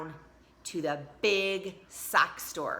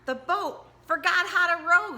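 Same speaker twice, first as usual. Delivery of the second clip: put-on high, louder indignant girl; Tina's voice; 12 semitones higher, 7 dB louder than usual. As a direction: complain like a flustered child. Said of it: "said Tina".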